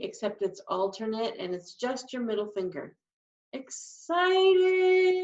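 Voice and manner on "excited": higher, bouncy excited word. Shown as a demonstration. Sign teacher showing the excited sign, eagerly. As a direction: lift it up with eager delight. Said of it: "you get an excited look on your face".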